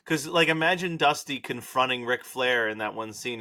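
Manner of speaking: ordinary delivery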